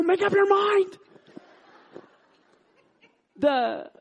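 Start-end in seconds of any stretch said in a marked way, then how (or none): 0.0-1.0 high, loud comic outburst; hammed-up exasperated shout; exasperated retort. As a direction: yell back at the robber, fed up, acted. Exasperated comeback to robber, retold.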